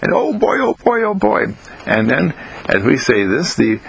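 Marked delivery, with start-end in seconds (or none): 0.1-1.6 put-on high, delighted run of oh boys; gleeful exclamation. Delighting in how the body responds, gleeful. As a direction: exclaim it high with mild glee.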